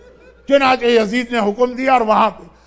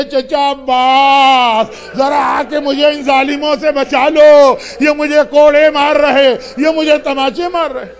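put-on loud, pleading cry of a small girl; child crying for help; 2 semitones higher, 4 dB louder, slower than usual. Sakina calls to uncle Abbas while being beaten, loud and pleading. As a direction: shout out to her uncle for rescue.

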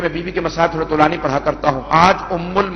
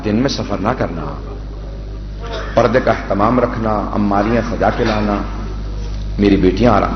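measured, mournful majlis recitation; masaib recitation; 3 semitones lower than usual. Acted. Reciter telling the Kufa story to mourners, mournful. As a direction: chant the tragedy to the mourners.

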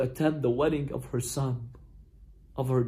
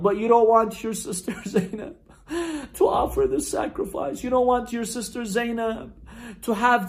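higher-pitched lament, breaking into sobs; tearful pleading; 5 semitones higher than usual. Acted. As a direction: plead through tears, catching the breath. Sister pleading, mourning preacher breaks up.

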